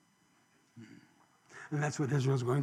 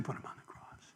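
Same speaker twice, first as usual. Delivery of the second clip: unvoiced confessional whisper; 16 dB quieter than usual. Real, not acted. Preacher confessing to congregation, whispered. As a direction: breathe it out as a confession. Confessing his own sin.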